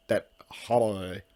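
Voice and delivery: "holiday" drawn out slowly in a would-be Irish way; mock Irish pronunciation. Real, not acted. Host jokingly claims it's how they say it in Ireland, mock Irish "holiday". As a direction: say "holiday" slow and squashed, as if Irish. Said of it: "That's how they say it in Ireland".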